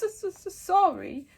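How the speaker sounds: stuttering tearful giant, high and loud; mild sobbing apology. A narrator's reading. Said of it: "sobbed Hagrid".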